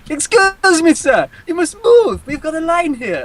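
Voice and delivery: pushy Italian waiter shooing a customer; Italian shopkeeper. Retold or imitated. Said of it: "Italian guy ... comes over and goes".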